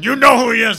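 loud, high, threatening accusation; loud high menacing demand; mock yell. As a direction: shove the question at him. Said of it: "It's like".

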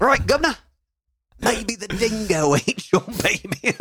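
high-pitched, hammed-up Australian accent; mock Aussie accent. Joking about dingoes and babies, hammed-up Aussie accent. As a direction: draw it out in a silly Australian accent. Continuing the Aussie dog bit.

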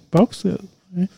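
normal talking voice

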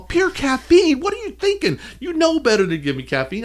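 very high, incredulous outburst; hammed-up outrage at a coworker; retold indignant scolding. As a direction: act out appalled disbelief, pitch shooting up.

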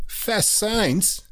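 sponsor name lifted high, ad-style; a hint of an ad read; announcing the sponsor. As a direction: sing out the brand name like a jingle.

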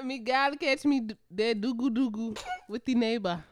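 botched Jamaican accent that comes out African. Shown as a demonstration. Using the slang in a sentence, bad Jamaican accent. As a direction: attempt Jamaican, land closer to African. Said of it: "That's my African Jamaican accent".

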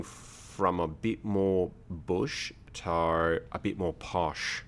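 slow, low put-on Aussie accent; Australian accent. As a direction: drift from bush Australian to posh. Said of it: "clicky accent".

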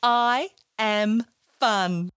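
put-on slow, each word placed for kids to copy; slow, deliberate model line; slow affirmation. Shown as a demonstration. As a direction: say it slowly so children can repeat. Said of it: "Can you say it with me?".

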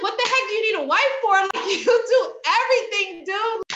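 high, loud, fast disbelief; incredulous. Retold or imitated. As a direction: marvel at him, high and loud. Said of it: "I'm like".

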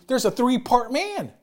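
normal talking voice

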